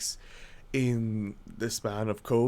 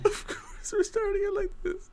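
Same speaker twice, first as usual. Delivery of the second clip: whiny fake sob, pitched way up; mock crying. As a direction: wail it like a hammy crybaby. Groggy host whines about bad sleep.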